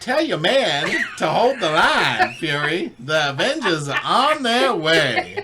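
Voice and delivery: put-on Southern accent as Captain America to Fury; Southern Chris Evans. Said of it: "I was doing Southern Chris Evans".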